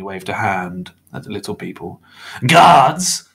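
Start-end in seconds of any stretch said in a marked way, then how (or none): 2.5-3.3 high, loud indignant bark; sharp shout of disbelief. A narrator's reading. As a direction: bark it as an outraged question. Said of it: "Mister Crepsley barked".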